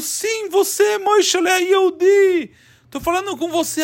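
a talking idol calling to the crying man; statue voice. Acted. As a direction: call him in as a sly idol. The statue answers him.

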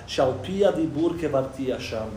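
ordinary talk

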